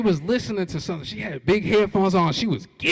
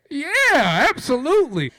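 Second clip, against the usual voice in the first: eager yes; 8 semitones higher, 6 dB louder than usual. Retold or imitated. Eager reply.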